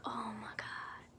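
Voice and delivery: slow hushed whisper; awed whisper. Retold or imitated. Friends amazed the door opened, whispered. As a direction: breathe it out in awe.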